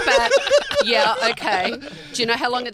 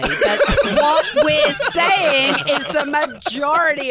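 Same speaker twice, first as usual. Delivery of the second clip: slow, louder, laughing through it; 6 semitones higher, 10 dB louder, slower than usual. Real, not acted. Co-host correcting the host while laughing. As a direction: explain while cracking up.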